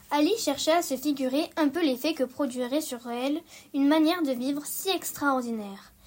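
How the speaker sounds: usual speaking voice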